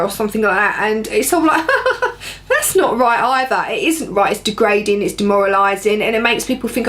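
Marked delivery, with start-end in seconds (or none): 1.7-2.4 quick laugh high up, then falling away; a real laugh breaking off high at the absurdity; a short, high, rueful laugh. Real, not acted. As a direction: let out a short disbelieving laugh. Laughing at sexist remark.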